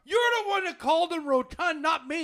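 high, loud defensive shout; indignant protest. Real, not acted. Coworker denying he called the porter rotund, indignant. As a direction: throw the blame back loudly.

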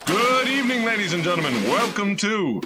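booming radio announcer. Acted. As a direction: announce it to the crowd. Announcer opening radio show.

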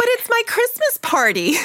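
high, whiny protest; mock whine. Daughter whining to her dad that it's her party. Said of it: "You're like".

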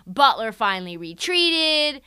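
ordinary delivery

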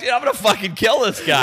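high-pitched mock fury; retold indignant complaint; exasperated. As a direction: vent comic outrage about someone.

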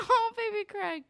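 high-pitched pitying coo; cooing sympathy. Real, not acted. Host pitying Craig after his favorite line, cooing. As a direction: coo over a poor little thing.

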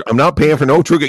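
the line "I'm not paying for no True Coat" thrown out loud, high, indignant; indignant outburst. Retold or imitated. Same line shouted louder.

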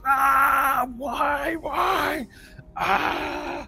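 high wailing cry, breaking; anguished wail. Acted. Cloaked man staring at his melting hands, anguished wail. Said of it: "he goes".

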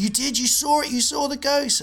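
high, loud nerdy insistence; insistent shout. Retold or imitated. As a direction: shout it at the mocking lads. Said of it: "Tony Robinson went".

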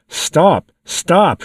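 a mildly read demon begging the bishop to stop; Satan crying out. A narrator's reading. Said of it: "cried Satan".

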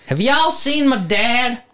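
put-on high, drawn-out Tennessee kid voice; little Tennessee boy. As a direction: imitate a small boy with a Tennessee twang. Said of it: "he sounds like a little Tennessee boy".

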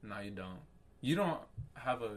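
usual speaking voice

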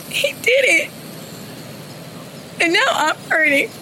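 high, tearful and pleading; weeping through the protest; crying. Real, not acted. Grieving daughter after execution.

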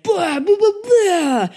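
very high, loud "Blah, blah"; mock dramatic vocalizing. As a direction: belt out the nonsense like a soundtrack. Mock slow-motion scene soundtrack.

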